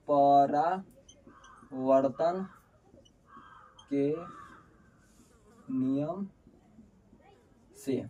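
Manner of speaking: word by word, long pauses between; halting, spaced-out dictation; slow dictation. Real, not acted. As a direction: say it as slowly as you would write it. Dictates rule while writing.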